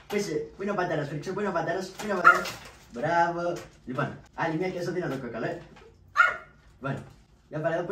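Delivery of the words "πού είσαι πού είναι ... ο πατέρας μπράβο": higher, louder, urging the dog on; loud dog command. Acted. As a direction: rouse the dog to jump.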